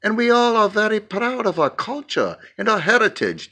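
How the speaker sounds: put-on lightly done African accent, heritage-proud; African pastor accent. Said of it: "Do you like my accent".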